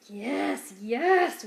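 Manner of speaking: ordinary delivery, nothing marked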